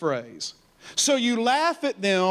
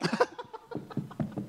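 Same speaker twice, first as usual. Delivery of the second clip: high breathy mocking laugh; 14 semitones higher, 10 dB quieter than usual. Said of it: "looking at his enemies like".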